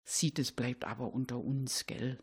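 leise, verschwörerisch raunen; vertraulich leise. Acted. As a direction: ganz leise, wie ein Geheimnis. Verschwörerisch unter uns gesagt. (leise und vertraulich unter uns)

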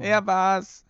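high, loud tattletale cry; mock accusation. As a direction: point the finger like a kid. Joining the mock radio play.

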